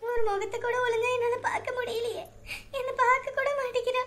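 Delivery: high, crying voice; tearful pleading. Acted. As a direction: sob out your hurt to your aunt. Mother crying over baby. (அத்தையிடம் அழுகையுடன் புலம்பும் குரல்)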